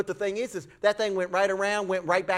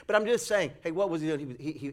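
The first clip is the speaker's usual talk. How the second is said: normal talking voice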